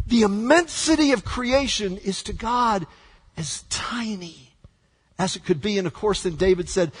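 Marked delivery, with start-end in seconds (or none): 3.4-4.5 tiny, slow whisper. Acted. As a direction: shrink the voice to a whisper. Emphasizing smallness.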